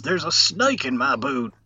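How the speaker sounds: high, slow, loud toy cowboy drawl; pull-string cowboy doll. Retold or imitated. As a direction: play it like a talking cowboy toy. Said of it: "pull the string in Brian Kelly's back".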